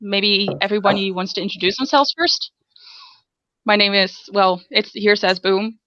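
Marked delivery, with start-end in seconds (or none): none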